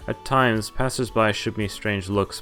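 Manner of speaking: usual speaking voice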